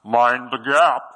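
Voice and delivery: slow, loud British announcer; loudspeaker voice. Retold or imitated. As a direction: boom it out like a station speaker. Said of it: "it kinda sounds like this".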